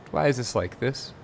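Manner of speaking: ordinary delivery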